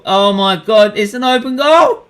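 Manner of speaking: high, loud, rising excitement; excited alarm. Real, not acted. Fan spotting an open goal live, rising excited shout. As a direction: build to a high shout at the chance.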